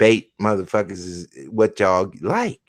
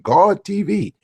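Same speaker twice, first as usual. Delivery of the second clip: mock hype host; 9 semitones higher, 4 dB louder than usual. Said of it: "I'll be like".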